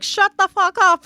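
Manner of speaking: slow, loud, high mock Irish snap; Irish person. Imitating how every Irish person would react, in a mock Irish accent. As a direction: imitate an annoyed Irishman telling him off. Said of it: "Every Irish person's like".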